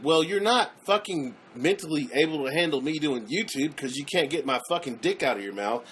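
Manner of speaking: normal talking voice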